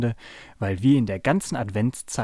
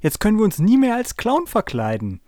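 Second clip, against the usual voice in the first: raised, higher-pitched indignant complaint; upset protest; 9 semitones higher, 4 dB louder than usual. Acted. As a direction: object loudly that the clown hair is ruined. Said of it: "protestierte ich".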